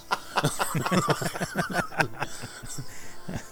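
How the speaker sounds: high helpless laughter; long laugh. Real, not acted. Friends cracking up over the van joke, laughing hard. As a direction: lose it completely laughing.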